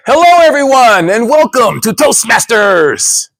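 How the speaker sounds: booming, slow stage welcome; loud mic check. Retold or imitated. Contestant testing the mic onstage, booming welcome. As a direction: project big into the mic. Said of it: "You don't have to yell".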